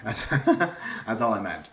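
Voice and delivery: laughing through the words; giggling it out, slow. Real, not acted. Embarrassed about the Ethan line, laughing.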